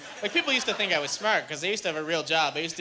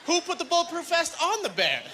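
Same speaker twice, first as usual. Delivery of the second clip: incredulous rant; 8 semitones higher than usual. Acted. Ranting about the bear vest.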